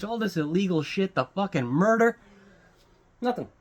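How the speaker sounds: usual speaking voice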